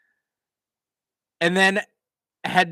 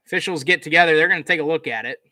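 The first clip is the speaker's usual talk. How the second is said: brisk, punchy sportscaster call, louder on the big moments; live play-by-play; 3 semitones higher, 6 dB louder, much faster than usual. Acted. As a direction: call the return like a TV announcer. Calls the game play-by-play.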